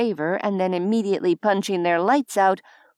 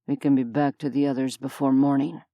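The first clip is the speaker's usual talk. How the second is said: low, steady man's voice; deep male voice; 6 semitones lower, faster than usual. A narrator's reading. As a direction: voice him low and calm. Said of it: "Leonidas said".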